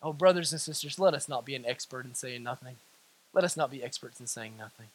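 very low, soft exhortation; hushed plea. Real, not acted. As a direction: drop right down and plead quietly.

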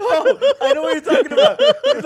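high, loud laughing words. Real, not acted. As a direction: talk through a burst of laughter. Funny video recognized.

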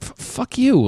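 sudden high fed-up swear; exasperated curse. Real, not acted. Ranting at critics.

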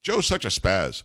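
low, offhand buddy tease; low-key affectionate jab; friendly ribbing. Retold or imitated. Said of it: "an endearment. If we were friends".

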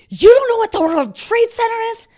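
shocked teacher snapping at a student. Retold or imitated. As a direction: snap at the student in disbelief. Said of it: "Missus Larson snapped at me".

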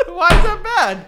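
high, loud question through laughter; giggling, squealed comeback; laughing protest. Real, not acted. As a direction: protest while cracking up. Laughing while being teased.

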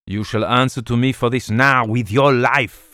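snarled menace at the man who caught him; snarling threat. Acted. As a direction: spit it at him like a cornered rogue. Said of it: "he snarled".

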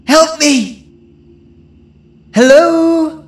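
loud high shouts for help; calling for help. Acted. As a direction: yell out desperately for help. Girl runs toward the light.

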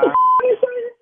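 high, loud stunned outburst; shocked outburst. Real, not acted. Husband reacting to friend's confession, stunned high shout. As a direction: blurt it out in disbelief.

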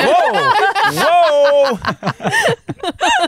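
high, laughing whoa whoa. Retold or imitated. Retold reaction to racism.

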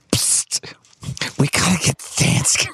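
urgent sneaky whisper; whispered plotting in the dark. Retold or imitated. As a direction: hiss it so no one wakes. Sneaking at night, sleeping friend.

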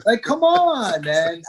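high-pitched, frustrated protest; exasperated cry of disbelief; exasperated plea. Retold or imitated. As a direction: appeal to the coach, pitch soaring. Repeats exasperated plea to coach.

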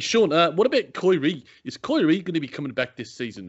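playful Kiwi reporter asking questions; Kiwi accent. Pretend. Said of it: "I can do a good Kiwi accent".

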